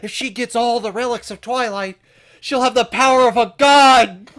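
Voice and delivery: high loud nerdy sidekick; Otacon voice. Pretend. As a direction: hype up the threat like a techie. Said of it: "My Otacon is not great".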